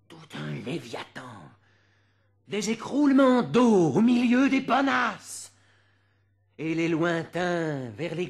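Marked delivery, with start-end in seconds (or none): 2.5-5.5 much higher and louder, full force; thundering. Acted. Reciter to hall, thundering high loud line. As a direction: let the water crash down.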